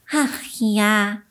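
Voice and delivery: sighing. A narrator's reading. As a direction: sigh it out, a little lonely. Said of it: "seufzte Willi da".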